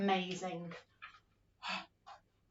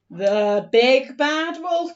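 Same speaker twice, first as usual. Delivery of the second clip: slow, loud, menacing intro; storyteller introducing the wolf; dramatic announcement; 2 semitones higher, 9 dB louder, slower than usual. Shown as a demonstration. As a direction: boom out the villain's name.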